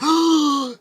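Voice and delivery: loud, high mock gasp; shocked gasp. Crowd hearing the robot's purpose, exaggerated shocked gasp. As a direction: gasp like a whole scandalized crowd.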